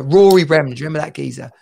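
ordinary delivery

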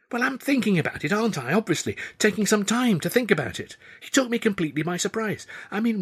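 put-on a flustered young woman thinking aloud; Tilly's voice. Tilly's voice, higher throughout.

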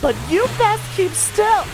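put-on a squeaky girl telling him to behave; wary girl. Girl warning the driver, high and squeaky. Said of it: "so here are the lyrics".

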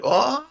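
drawn-out, high rising "ohhh"; group "oh" reaction. Retold or imitated. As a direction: imitate the crowd reacting to a challenge. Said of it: "everyone's just like".

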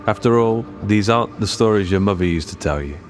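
low, ominous announcer read; dramatic trailer voice. Acted. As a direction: tease it like a horror trailer. Dramatic podcast intro tagline.

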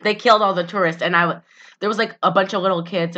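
usual speaking voice

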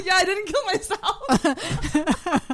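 slow, laughing through words. Retold or imitated. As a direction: giggle it out slowly. Retold own reply, laughing.

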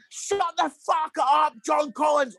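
high, loud, slow furious shout; screaming. Retold or imitated. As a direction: scream it at the pundit. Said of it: "I was, like, screaming".

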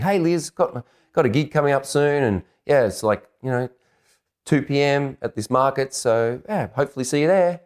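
high, hopeful sing-out of an email; put-on casual invitation. Man emailing the girl about his gig, high and casual. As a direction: invite her casually, pitched well up.

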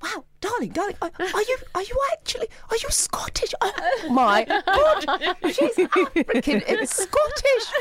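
very high, gushing disbelief; hammed-up stunned stranger, slowing at the end; mock amazement. Gushing stranger asks if she's really Scottish, mock amazement.

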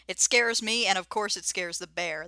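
ordinary talk, nothing marked

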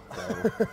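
high, sudden burst of laughter; embarrassed laugh. Real, not acted. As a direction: laugh at your own bad attempt. Laughs at own bad accent.

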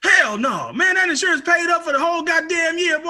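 high, loud, furious shouting; furious dad. Retold or imitated. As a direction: yell at the cop's nerve like an outraged father. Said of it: "he was pissed, dog".

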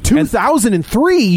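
slow stunned echo of the year; incredulous repeat. Real, not acted. Repeats in disbelief.

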